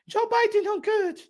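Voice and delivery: hammed-up impression of the takeout cashier; imitating the Chinese restaurant lady. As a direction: put on a high little voice for the shop owner. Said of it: "la china me dijo".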